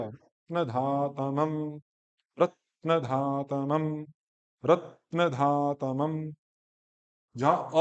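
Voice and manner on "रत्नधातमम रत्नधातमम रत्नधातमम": रत्नधातमम slowly, धा straight after न; slow correct model. Shown as a demonstration. Teacher drills the word slowly for student. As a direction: glide from न into धा with no pause. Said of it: "न के तुरंत बाद धा".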